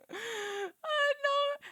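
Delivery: high, breathy laugh into oh no; laughing cry. Real, not acted. Laughing at herself after ranting, a high oh no. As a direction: laugh at her own rant, half groaning. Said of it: "Made me rant".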